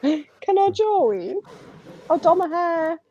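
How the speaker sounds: high, very slow drawn-out ask; squeaky teasing plea; playful high put-on voice; mock high voice. Joking about joining Only Gingers.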